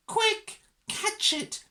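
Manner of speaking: very high, urgent cry; urgent shout. A narrator's reading. Nurse wants Mog caught, high urgent shout. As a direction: call out sharply for help. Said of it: "shouted the nurse".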